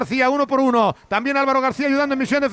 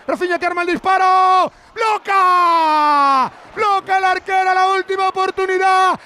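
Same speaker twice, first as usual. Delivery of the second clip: shouted play-by-play; 7 semitones higher, slower than usual. Real, not acted. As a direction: yell the save at full force. Last chance, keeper saves. (grito de parada en la narración)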